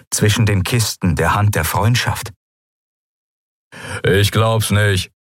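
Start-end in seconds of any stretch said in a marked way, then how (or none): 4.0-5.1 lightly voiced, drawn-out Skria call; a slow exclamation in a character voice. A narrator's reading. Skria pirate in astonishment on the deck above, calling. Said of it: "hörte sie einen Skria rufen".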